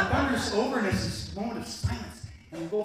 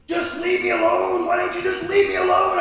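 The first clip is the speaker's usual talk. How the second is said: exasperated shout; 11 semitones higher, 8 dB louder than usual. Retold or imitated. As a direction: shout it out the window into a storm. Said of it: "I'm shouting out the window".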